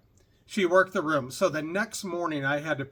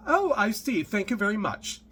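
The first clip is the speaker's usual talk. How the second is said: high-pitched bad accent impression; Doctor Ruth; 6 semitones higher, 3 dB louder than usual. Passenger answering the lame excuses, high mock accent. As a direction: imitate her accent, pitched well up. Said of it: "I'm doing a horrible accent here".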